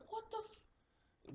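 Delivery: high, slow, breathy squeak of shock; stunned disbelief. Retold or imitated. Teen reacting to the cop's threat, high and stunned. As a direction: squeeze it out in disbelief.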